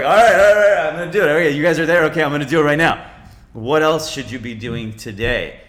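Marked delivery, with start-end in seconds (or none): 0.0-3.1 loud, high, eager acting-out of a listener; mock fired-up listener; hyped-up agreement. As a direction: burst out all pumped to do it now. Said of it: "You're like".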